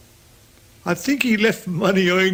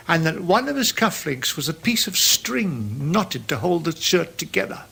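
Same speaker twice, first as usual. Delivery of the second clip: usual speaking voice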